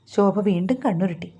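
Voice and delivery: usual speaking voice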